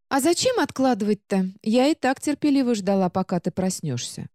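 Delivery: high book voice, matter-of-fact; calmly patient little book. Acted. Book answers heroine.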